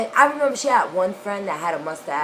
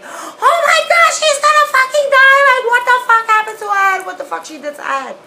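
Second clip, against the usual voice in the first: much higher, louder hysterical shrieking; dramatic hysterical relatives; 9 dB louder than usual. Retold or imitated. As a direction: shriek it like panicked family. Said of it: "Everybody's like".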